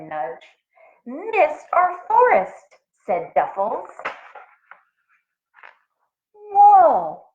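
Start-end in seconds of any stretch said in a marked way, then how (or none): 1.0-2.8 higher, slow otter voice; a hint of a friendly otter; lightly voiced otter finishing a thought. A narrator's reading. 6.3-7.3 high, loud amazed whoa; big wide-eyed whoa. A narrator's reading. Narrator marvels again.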